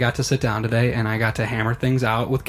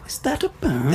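high-pitched fake English warm-up; mumbled mock English, pitched up; mumbled English accent; 12 semitones higher than usual. Shown as a demonstration. Showing how to find it, mumbled English accent. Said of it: "in a mumble fashion".

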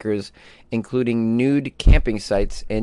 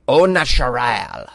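mock French accent; slower than usual. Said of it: "That's my French accent".

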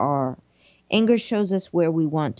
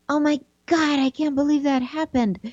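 mock panicked voice; 7 semitones higher, faster than usual. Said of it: "it doesn't say".

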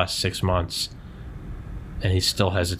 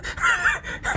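high laugh; 4 dB quieter than usual. Real, not acted. Bursts out laughing.

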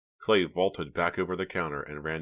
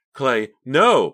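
high, very loud cry of alarm; screaming; 9 semitones higher, 12 dB louder than usual. A narrator's reading. Pam tries to stop Clay's attack, screaming. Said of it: "Pam screamed".

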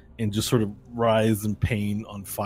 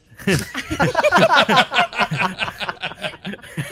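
villain laugh; 12 semitones higher, 7 dB louder than usual. Acted. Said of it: "And he goes".

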